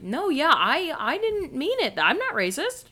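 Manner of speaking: imitated high, flustered denial; mock someone leaping to their own defense; defensive protest. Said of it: "goes right into the fight thinking".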